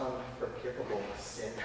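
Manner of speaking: very low, barely audible aside; hushed dread. Acted. Boy names the worst sin of all, hushed. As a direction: drop to a secretive hush.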